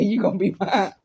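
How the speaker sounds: ordinary delivery, nothing marked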